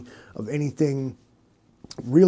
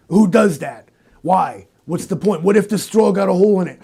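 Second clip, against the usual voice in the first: normal talking voice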